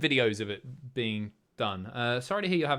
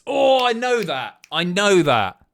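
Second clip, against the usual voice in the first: excited recognition; 7 semitones higher, 11 dB louder, slower than usual. Real, not acted. Recognises a food from chat.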